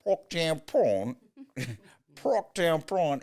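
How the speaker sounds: put-on thick South African accent, high and slow, Parktown prawn. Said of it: "with this adorable, thick South African accent".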